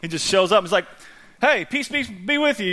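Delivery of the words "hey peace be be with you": higher-pitched, breezy mock greeting; casual Jesus greeting. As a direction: pop in with a casual, high hello. Voices Jesus casually showing up.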